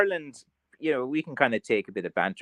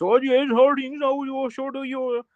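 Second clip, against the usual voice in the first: put-on Kerry bogger accent. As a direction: drone it like a rural Kerry bogger. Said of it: "and that kind of drone".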